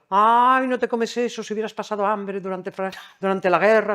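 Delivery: hammed-up uncle nagging about hunger in the war; mocking old uncle. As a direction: mimic a grumbling uncle lecturing a child. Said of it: "mi tío me decía".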